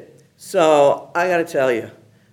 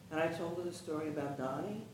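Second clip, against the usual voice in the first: ordinary talk, nothing marked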